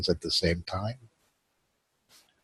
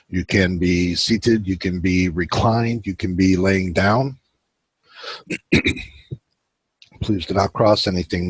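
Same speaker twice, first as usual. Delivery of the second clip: guided meditation; 3 dB quieter than usual. Acted. Host guiding his viewers into relaxation, calm guided meditation. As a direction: ease the listeners into stillness.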